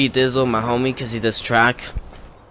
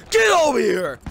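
high-pitched shouted command; angry guard's yell; 11 semitones higher than usual. Retold or imitated. Security guard ordering a kid back out of the gym, shouting.

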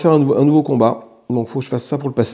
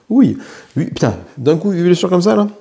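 dismayed surprise; 5 semitones higher than usual. Real, not acted. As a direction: react to losing eight wounds at once. Huit blessures d'un coup au jeu. (surprise consternée, plus aiguë et plus forte)

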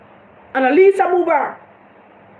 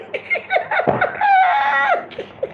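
shrieking laugh. Real, not acted. Cracking up at his own Bunting impression. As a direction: squeal with laughter. Bursts out laughing after quote.

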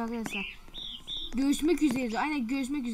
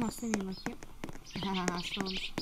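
whispering; 5 semitones lower, 6 dB quieter than usual. Real, not acted. Whispered aside.

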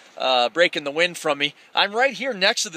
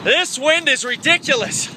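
shouting over wind; 8 semitones higher than usual. Real, not acted. Strong gusts on the ridge.

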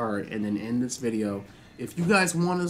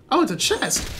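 excited; 10 semitones higher, 4 dB louder than usual. Real, not acted. Excited find in game.

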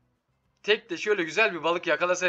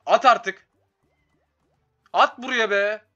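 excited shout; 5 semitones higher, 7 dB louder, slower than usual. Real, not acted. Streamer urging slot to pay.

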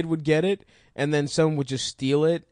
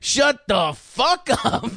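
drawn-out mock host yell; slow mock yell; 8 semitones higher, slower than usual. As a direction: slowly shout it like a host. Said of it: "that's right".

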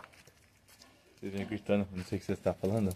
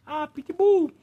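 mocking whine; 4 dB louder, much slower than usual. Mocking people.

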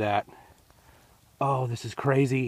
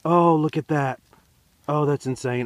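loud, raised-pitch astonishment; thrilled amazement; 5 semitones higher, 7 dB louder than usual. Real, not acted. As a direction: exclaim it, overjoyed at the find. Still amazed at the date.

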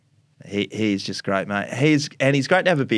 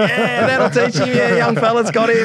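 laughing mate; 13 semitones higher, 6 dB louder than usual. Retold or imitated. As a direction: cackle it out gleefully. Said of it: "He didn't stop laughing".

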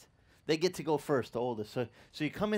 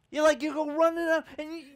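high, loud eager rush; child dashing to hug relatives, high and excited; 11 semitones higher, 5 dB louder than usual. Retold or imitated. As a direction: run up like a thrilled kid.

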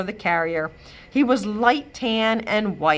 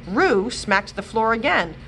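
ordinary delivery, nothing marked

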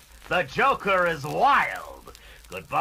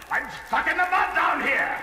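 calling for help; 6 semitones higher than usual. Acted. Joker calls up from mud.

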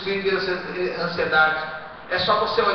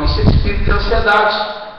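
declare it loud; 8 dB louder than usual. Shown as a demonstration. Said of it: "Fala assim pro irmão".

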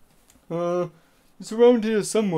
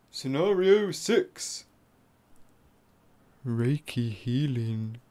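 slow, low hushed title; ASMR voice; 7 semitones lower, 5 dB quieter, much slower than usual. Acted. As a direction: draw the title out calmly.

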